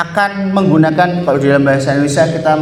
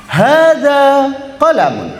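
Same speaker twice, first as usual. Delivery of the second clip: higher, slow, eager reply; answering a friend; 8 semitones higher, slower than usual. Pretend. Student answering a friend who wants a pen, eager. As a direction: hand over the pen proudly. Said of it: "Terus kamu bilang".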